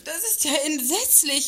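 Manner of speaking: horrified reaction to the news of a death; shocked. Acted. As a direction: recoil at the terrible news.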